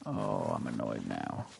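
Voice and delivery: low, slow grumble; sulky mutter. Real, not acted. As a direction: sink into annoyance. Deflated after the miss.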